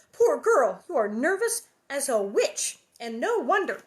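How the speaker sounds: lightly voiced mother pitying a girl out loud; exclaiming Ma. A narrator's reading. Reading Ma's exclaimed line.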